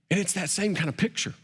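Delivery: ordinary talk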